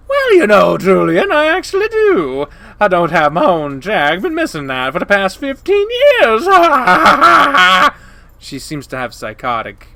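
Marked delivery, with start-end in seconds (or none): 0.1-6.5 high, blaring coyote woman, psychotic edge; loud, obnoxious Janice. Acted. Janice admits to Julian she needs help, loud and obnoxious. 6.5-8.3 high, shouted cackling laugh; psychotic cackle. Acted. Janice laughs at her own remark, psychotic cackle.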